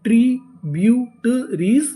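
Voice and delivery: slow, drawn out with a rising end; slow spelled-out build. Shown as a demonstration. Teacher slowly sounding the word for the class. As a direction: stretch each syllable, lift at the end. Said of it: "पूरी meaning क्या बनी?".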